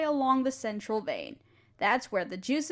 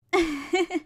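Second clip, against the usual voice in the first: light laugh; 4 semitones higher than usual. Acted. Teacher laughs at apprentice's offer.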